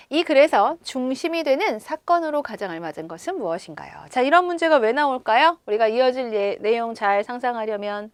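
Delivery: usual speaking voice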